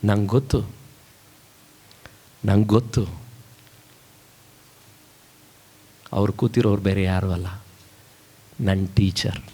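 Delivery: low, halting voice of the weeping violinist; acted grave, low-pitched confession; subdued reply. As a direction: answer heavily, with long pauses. Violinist answers about seated teacher.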